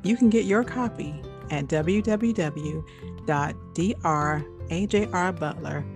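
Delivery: normal talking voice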